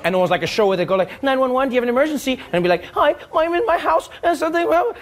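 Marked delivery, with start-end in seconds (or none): 1.2-2.4 brisk, higher-pitched TV dispatcher; emergency operator. Acted. TV operator answering an emergency call, brisk. 3.0-5.0 high-pitched mock emergency caller; panicky caller. Caller reports trouble to TV operator, high-pitched.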